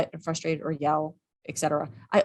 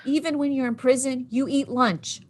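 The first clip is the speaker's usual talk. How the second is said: mock exasperated lecture; 6 semitones higher than usual. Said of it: "I'd be, I'd be like".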